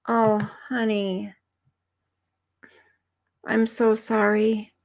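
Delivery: soft, slow consoling; soft, slow sympathy for a viewer's sad news; sympathetic comfort. Real, not acted. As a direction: gently comfort a grieving friend. Consoling a viewer about her loss.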